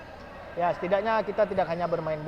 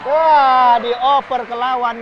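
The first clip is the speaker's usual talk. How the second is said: sudden loud wah, then slower; excited; 6 semitones higher, 11 dB louder, much slower than usual. Real, not acted. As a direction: caught off guard by the pass. Sudden turnover during play.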